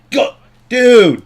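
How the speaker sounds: high, loud burst of anger; mad shout. Real, not acted. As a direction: yell it out, really mad at the game. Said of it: "I'm so mad right now".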